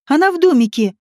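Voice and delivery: mild shout to someone; understated urgent yell. A narrator's reading. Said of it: "закричала ему Слава".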